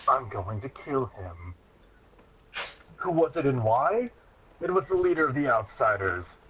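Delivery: ordinary talk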